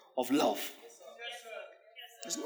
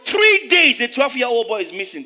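much higher, much louder laughing cry; laughing shout; 4 semitones higher, 9 dB louder than usual. Real, not acted. Preacher shouting about a boy missing three days. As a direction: yell it in mock alarm.

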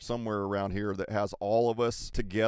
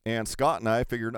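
ordinary delivery

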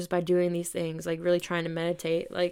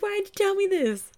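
much higher, louder mock mom protest; mom's reaction; 13 semitones higher, 5 dB louder than usual. Imagining her mom watching, high loud mock protest. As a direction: squeal it like a startled mom. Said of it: "she's gonna be like".

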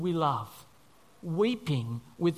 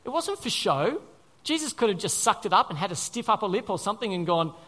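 usual speaking voice

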